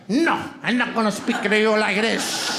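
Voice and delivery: hammed-up Spanish accent; comic Spanish-accented wife impression; wife's imagined accent. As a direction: refuse in a thick mock Spanish accent. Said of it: "but in my head".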